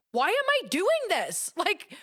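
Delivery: high-pitched retold exasperation, breaking into laughter; mock exasperation. Author recalling endless rewrites in her craft room, comically exasperated.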